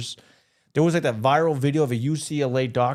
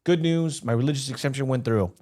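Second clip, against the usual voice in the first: normal talking voice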